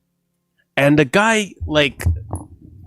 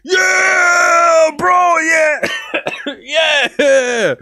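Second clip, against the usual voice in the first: high, loud cheering yell; hyped shouting; 13 semitones higher, 6 dB louder, much slower than usual. Retold or imitated. Bros pouring cereal cheering, high loud whoops. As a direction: whoop it up like a rowdy crew. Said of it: "they're over here like".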